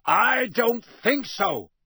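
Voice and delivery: high, slow, very loud roar; drawn-out roared refusal. Acted. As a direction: bellow it out word by word. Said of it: "roared Moody".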